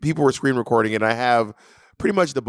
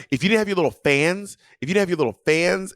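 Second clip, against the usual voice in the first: higher-pitched imitation of a taunting opponent; mock taunt; 6 semitones higher than usual. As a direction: jab at them, imitating a sore debater. Retelling opponent's taunt in debate.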